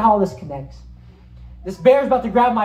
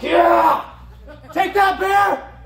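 victory shout; 11 semitones higher, much slower than usual. Retold or imitated. Preacher reliving his dream win to the church, victory shout. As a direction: crow over the beaten bear. I was like.

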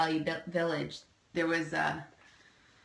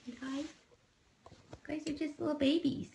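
sweet high pet voice; 6 semitones higher, 5 dB quieter than usual. Real, not acted. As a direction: coo it like talking to a newborn kitten. Cooing at kittens.

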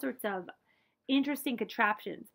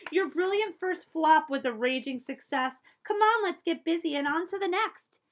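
encouraging great aunt; 7 semitones higher, 6 dB louder than usual. Acted. Reading a picture book aloud.

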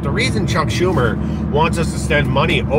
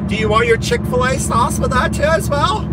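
much higher, put-on customer-service voice; mock fast-food worker; 11 semitones higher than usual. Mocking an imagined cashier, high put-on voice. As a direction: ham up a squeaky Chick-fil-A cashier.

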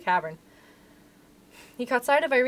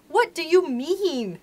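drawn-out, high, loud exclamation of disbelief; puzzled exclamation; 7 semitones higher, 5 dB louder, much slower than usual. A narrator's reading. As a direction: exclaim it slowly, pitch leaping up. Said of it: "exclaimed Curdie".

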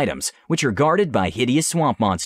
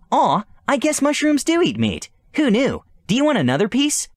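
high, cooing delight; charmed princess; 13 semitones higher than usual. Acted. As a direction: coo over the cute little eater. Charmed by the creature.

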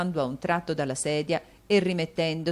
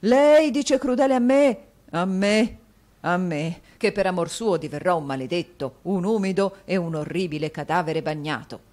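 indignant exclamation; 3 semitones higher, 7 dB louder than usual. A narrator's reading. Husband to wife, loud wounded outcry. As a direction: protest dramatically. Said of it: "esclamò il signor Mantalini".

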